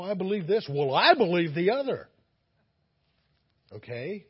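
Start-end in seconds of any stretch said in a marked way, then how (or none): 0.0-2.1 loud, pushy back-and-forth voices; mock stubborn insistence. Preacher mimicking two arguing believers, loud and stubborn. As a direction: insist loudly, playing both sides.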